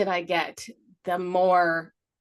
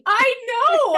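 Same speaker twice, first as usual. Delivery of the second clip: high, loud wail; loud, high-pitched giving in; exasperated agreement; 8 dB louder than usual. Real, not acted. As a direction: squeal it in comic despair. Wailing agreement to teasing.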